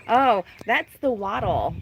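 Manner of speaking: ordinary delivery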